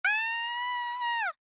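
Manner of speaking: high piercing scream of shock. A narrator's reading. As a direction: shriek at the mirror. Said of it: "Then she screamed".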